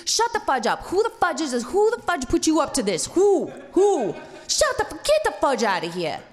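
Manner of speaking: loud, high mock tirade; retold shouting, punching each question; irate New York cop. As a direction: rant as an exasperated New York sergeant. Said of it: "a New York tater hater".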